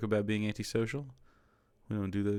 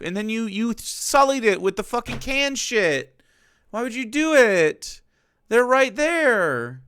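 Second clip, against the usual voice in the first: high, slow, stretched-out outraged whine; exasperated rant; 12 semitones higher, 7 dB louder, slower than usual. Real, not acted. Scolding the cooking video for using canned pesto, high exasperated whine. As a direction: plead in disbelief with whoever ruined it. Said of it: "I'm mad".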